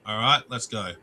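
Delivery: ordinary talk, nothing marked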